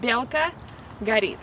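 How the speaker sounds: "белка" said with a clear B, BYEL-ka; Russian word. Shown as a demonstration. Pointing out the B sound to viewers, careful Russian. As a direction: stress the B in "белка". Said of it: "with the B".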